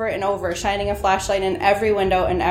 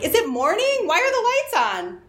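retold scream; 10 semitones higher than usual. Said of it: "I screamed".

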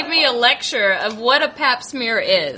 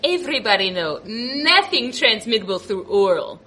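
a flat, lower take on the doctor; lightly imitated doctor, lower; doctor's voice; 7 semitones lower than usual. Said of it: "she looked at me and she goes".

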